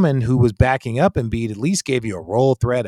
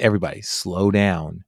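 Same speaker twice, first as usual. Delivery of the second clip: calming; 8 semitones lower, 6 dB quieter than usual. Retold or imitated. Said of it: "you always tell".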